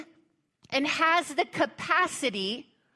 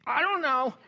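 mock clueless God; 4 semitones higher than usual. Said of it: "God's like".

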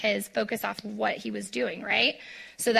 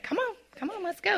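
Jesus beckoning; 9 semitones higher, 3 dB quieter, faster than usual. Acted. Jesus voiced beckoning Peter.

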